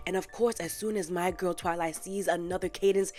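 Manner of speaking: ordinary talk, nothing marked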